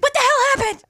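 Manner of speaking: high, loud alarmed question; panicked mom. Retold or imitated. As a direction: shriek it like a frantic mom. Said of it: "She's like".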